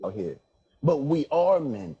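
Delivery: normal talking voice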